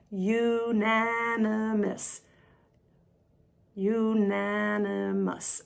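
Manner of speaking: "unanimous" at half speed, syllable by syllable; drawn-out unanimous. Shown as a demonstration. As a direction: stretch "unanimous" slowly, holding each sound. Stretching the word for learners.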